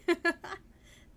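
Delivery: high, loud giggle; giddy laugh. Real, not acted. Streamer laughing with joy at the sub. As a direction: burst into a delighted giggle.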